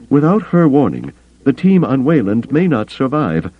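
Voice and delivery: high-pitched alien counselor pressing his case; urgent Bothan. A narrator's reading. Bothan warning Carter about the team on Wayland, urgent.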